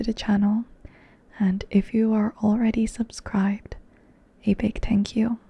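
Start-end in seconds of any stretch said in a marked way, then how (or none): none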